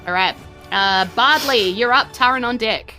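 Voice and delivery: usual speaking voice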